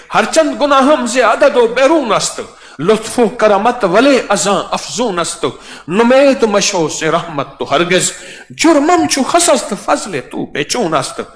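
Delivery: poem declaimed in a lifted, melodic voice; recited Persian verse. Acted. Preacher reciting a Persian quatrain to the congregation. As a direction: declaim the rubai with feeling.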